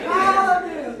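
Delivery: loud, high cry fading at the end; mock church shout. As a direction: call out like the Pentecostal faithful. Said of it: "vejo igreja pentecostal assim".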